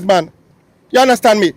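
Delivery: usual speaking voice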